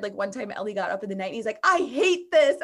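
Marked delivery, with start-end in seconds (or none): 1.6-2.5 a half-played tired snap of frustration, a bit higher and louder; mock frustrated outburst. Wife retelling her husband's tired late-night outburst, mock frustrated. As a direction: toss it off like a fed-up, sleep-deprived husband.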